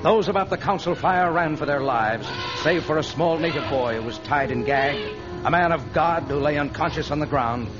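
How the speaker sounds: usual speaking voice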